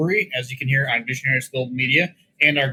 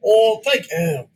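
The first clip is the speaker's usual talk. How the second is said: bad put-on British accent, high and slow; hammy British accent; 8 semitones higher, 3 dB louder, much slower than usual. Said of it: "your best British accent".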